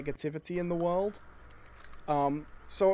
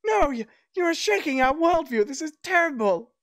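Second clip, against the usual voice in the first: high, louder hammed-up peasant outrage; mock distressed peasant; 10 semitones higher, 6 dB louder than usual. Peasant upset that Elfo praises his food, hammed-up high outrage. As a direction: play the peasant as if his world is crumbling.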